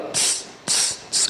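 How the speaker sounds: quiet tss, tss, tss hisses; teacher imitating stones clicking; hissing tss like stones. Shown as a demonstration. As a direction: spark noise of two stones striking. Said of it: "Batendo duas pedrinhas ali".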